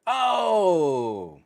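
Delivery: a sudden high, loud "oh"; surprised oh. Real, not acted.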